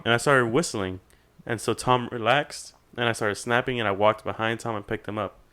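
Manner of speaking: usual speaking voice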